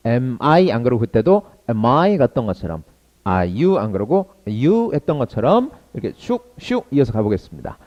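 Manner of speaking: normal talking voice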